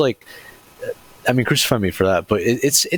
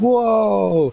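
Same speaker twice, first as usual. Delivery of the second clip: high, excited mock gasp of awe; mock whoa; 12 semitones higher than usual. Friend describing a 3D movie gimmick, squeals whoa. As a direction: act out a 3D jump scare with a big whoa. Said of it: "and it's like".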